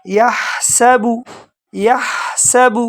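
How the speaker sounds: slow یحسب, ح from the throat; tajweed یحسب. Shown as a demonstration. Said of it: "ہا کو بالکل گلے کے شروع سے".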